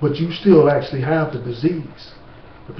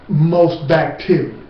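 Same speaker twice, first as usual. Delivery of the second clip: usual speaking voice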